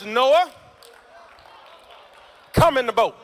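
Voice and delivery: full-voiced preacher call; loud call. Shown as a demonstration. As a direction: call it out loud to the far side of the room. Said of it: "I'm gonna talk to this side".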